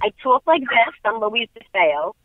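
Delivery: broad Long Island accent demo. Shown as a demonstration. Actress showing how she talks back home, Long Island accent. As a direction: show off your Long Island talk. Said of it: "My peeps are from, like, from Long Island".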